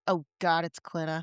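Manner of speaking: low, soft deadpan groan of recognition; imitating muted dismay, low and quiet; quoted dread. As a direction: quote it under your breath, unimpressed. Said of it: "but you said".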